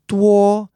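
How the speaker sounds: duo held on one level pitch; flat first tone. Shown as a demonstration. Teacher demonstrates first tone, duo held level. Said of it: "which is the flat tone".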